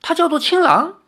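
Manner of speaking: high, incredulous interruption; blurted question. A narrator's reading. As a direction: cut in, unable to hold the question back. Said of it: "忍不住开口".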